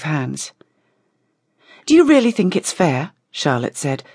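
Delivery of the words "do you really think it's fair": higher-pitched, pointed question; indignant challenge. A narrator's reading. Charlotte challenges the visiting vampires about discussing Violette, indignant.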